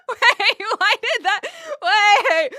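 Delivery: high, loud shrieks through real laughter; cracking up, very high and loud; flustered laughing. Real, not acted. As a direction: squeal it helplessly between bursts of laughter. Flustered laughing fit at pick-up line.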